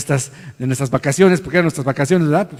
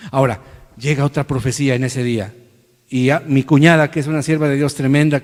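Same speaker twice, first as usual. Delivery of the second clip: usual speaking voice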